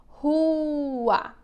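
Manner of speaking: long, drawn-out RUA; slow classroom dictation of rua. Shown as a demonstration. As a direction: dictate "rua" slowly, holding the vowels. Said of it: "eu vou ditar algumas palavrinhas".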